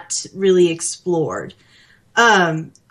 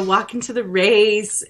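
ordinary delivery

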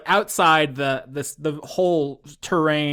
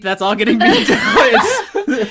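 laughing; 9 semitones higher, 6 dB louder, slower than usual. Real, not acted. Laughing about censoring.

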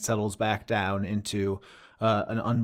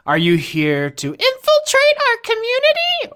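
shrill suspicious head; 7 dB louder than usual. Acted.

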